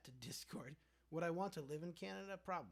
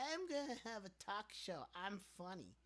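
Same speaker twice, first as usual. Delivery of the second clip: a lightly voiced, higher, smug YouTuber; mock celebrity boast; 5 semitones higher than usual. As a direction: toss it off like a self-important star. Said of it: "someone who's like".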